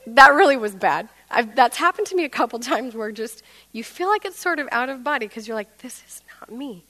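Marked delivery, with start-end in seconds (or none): 5.8-6.8 barely voiced whisper; hushed disbelief. Talking to oneself out of body, stunned whisper.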